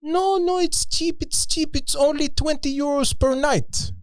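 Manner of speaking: very high, squeaky mock French protest; French accent. Island host reassuring tourists on price, high French accent. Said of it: "it was a French accent".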